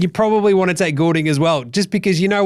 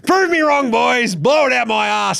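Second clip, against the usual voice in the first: mock coach challenge; 8 semitones higher than usual. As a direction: throw down the challenge in a higher voice. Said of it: "he says".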